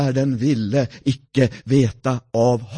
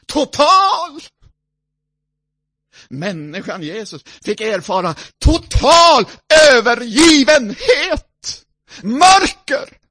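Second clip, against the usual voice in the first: higher, louder outcry; preaching cry; 11 semitones higher, 7 dB louder than usual. Acted. Preacher crying out Jesus' abandonment. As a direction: cry out the forsakenness.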